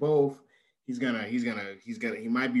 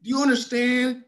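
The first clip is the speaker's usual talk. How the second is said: emphatic exclaiming; 10 semitones higher, 5 dB louder than usual. Real, not acted. Exclaiming the point.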